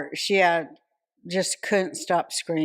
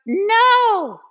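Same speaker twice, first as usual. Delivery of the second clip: very high, slow, loud mock refusal; arguing girlfriend; 6 dB louder, much slower than usual. Girlfriend refusing her boyfriend upstairs, high mock wail. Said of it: "And she's like".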